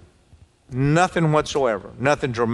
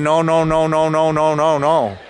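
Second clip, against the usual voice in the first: louder, droning string of refusals; mock protest; 3 semitones higher, 5 dB louder than usual. Preacher voices women in congregation refusing, mock insistent protest. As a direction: imitate women insistently refusing on one held note. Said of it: "some of you women are saying".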